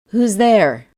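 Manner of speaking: startled. A narrator's reading.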